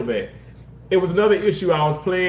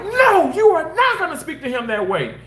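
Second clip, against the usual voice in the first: high, firm scolding; stern doctor rebuke; 13 semitones higher than usual. Retold or imitated. Doctor rebuking the patient, firm and raised. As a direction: shut her down like a protective doctor. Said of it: "the doctor's like".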